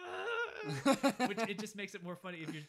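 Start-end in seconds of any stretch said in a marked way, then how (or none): 0.0-1.3 loud, high mock groan; exaggerated strained grunt, close to laughing; straining grunt. Boy shoving the hand out the window, loud strained grunt. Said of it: "grunting, like".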